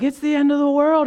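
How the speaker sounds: high-pitched doomsday cry; mock panic. Everyone in twenty twenty foretelling doom, in mock panic. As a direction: pitch it way up like an exaggerated alarm.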